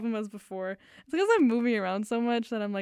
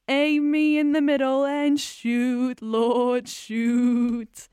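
put-on slow, much louder English accent, declaimed; 2 semitones higher, 10 dB louder, much slower than usual. The song's spoken-word climax, loud slow English accent. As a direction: build it up like a rock frontman, English accent. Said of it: "absolutely needs to be an English accent".

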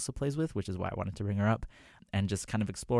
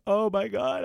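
high, louder starstruck "oh my God"; excited gasp; 12 semitones higher, 5 dB louder than usual. Retold or imitated. Fan freaking out at the singer's compliment, excited.

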